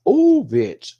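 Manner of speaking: loud, high burst of amazement; stunned exclamation. Real, not acted. Reviewer gushing to viewers over the performance, stunned exclamation. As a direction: blurt it out, pitch leaping up.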